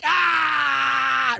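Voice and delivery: high "ah" right in his face; drawn-out, high mocking holler; mock laughing scream. Comic laughing in the mayor's face, high mocking yell.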